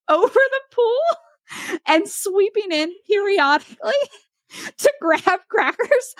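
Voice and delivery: high, giggling words broken by laughter; can't stop laughing while telling it; laughing through it. Real, not acted. Telling friends about the bird tornado, laughing through the words.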